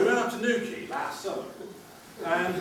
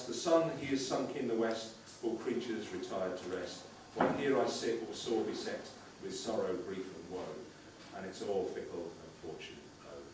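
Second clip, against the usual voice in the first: low, soft, mournful verse reading; solemn lament recitation; poem recitation; 10 semitones lower, 8 dB quieter than usual. Acted. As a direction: recite it sorrowfully. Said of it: "Burns writes".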